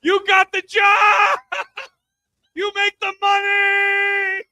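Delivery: high, loud, slow shouting at his wife; mock cheer. Husband yelling encouragement at his wife in bed, hammed-up. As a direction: cheer her on like a courtside fan. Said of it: "I'm like".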